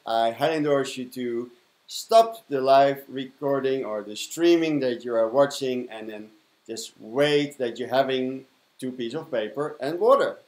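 usual speaking voice

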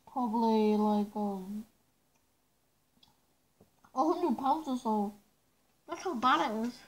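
muffled behind rubber mask; speaking through respirator filter; through a gas mask. Real, not acted. Seen 3: Full gas mask covers face throughout; references show bare face.

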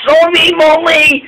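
high-pitched, urgent call; shouted apology. A narrator's reading. Caitlin bumps into Molly and shouts sorry. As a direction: call the apology out from a runaway engine. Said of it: "shouted Caitlin".

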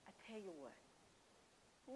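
slow and hushed, trailing up like a hesitant offer; hesitant soft drawl; soft, drawn out. Retold or imitated. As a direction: stretch "I tell you what" slowly and softly, rising at the end. Same refusal repeated to boss.

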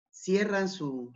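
usual speaking voice

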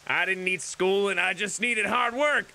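put-on country accent. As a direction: play a folksy country-accent grumbler. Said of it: "in this accent".

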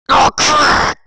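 high, very loud outburst; loud cartoon panic; panicked yell. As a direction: blurt it out in alarm. Panics as police threaten arrest.

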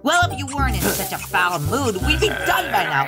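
rising angry retort; irritated scolding. Acted. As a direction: build from grumbling to a high outburst. Quarrel while hanging lights.

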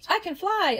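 high, delighted exclamation; excited shout. A narrator's reading. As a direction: call out high, acted excitement. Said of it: "Elizabeth shouted".

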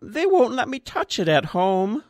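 a hint of a hurt old child; high, slightly wobbly complaint; plaintive old man. A narrator's reading. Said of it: "he looked as though he was going to cry".

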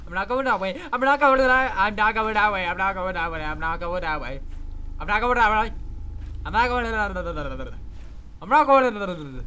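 loud nervous repetition; panicked repeating. Real, not acted.

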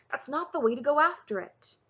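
loud, high correction; crying out. A narrator's reading. Cole correcting Taryn's hammering, crying out. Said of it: "Cole cried".

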